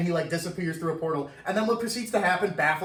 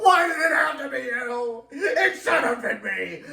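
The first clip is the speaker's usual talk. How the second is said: higher, louder hammed-up sobbing cry; mock crying shout; 7 semitones higher, 3 dB louder than usual. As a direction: overplay the grief, half laughing. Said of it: "they go on like that".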